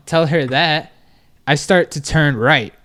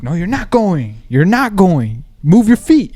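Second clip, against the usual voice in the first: imitated firm order, building higher and louder; brother's firm order; 4 semitones higher, 4 dB louder than usual. Brother telling the jet skier to move, firm imitated order. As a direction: retell a brother's insistence, climbing to a raised last command. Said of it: "That's how my brother said".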